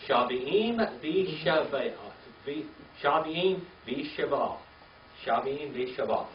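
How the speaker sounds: Shaviim v'shava read slowly, syllable by syllable; teacher modelling Shaviim v'shava for class; slow Hebrew reading. Shown as a demonstration. Teacher to class, slow careful Hebrew.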